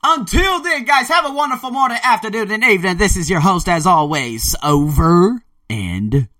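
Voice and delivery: host sign-off. Acted. Host signing off to his podcast listeners, announcer-style. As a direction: sign off the show with big host energy.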